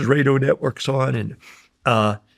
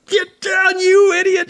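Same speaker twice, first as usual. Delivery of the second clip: gunny's shout; slower than usual. Retold or imitated. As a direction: scream at him to hit the dirt. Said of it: "the gunny's going".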